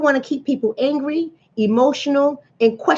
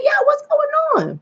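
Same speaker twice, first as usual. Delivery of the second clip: concerned question. Retold or imitated. Retelling concerned question to twin.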